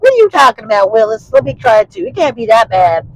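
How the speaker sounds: a hint of a skeptical schoolteacher; teacher voice. Teacher scolding kid, voiced.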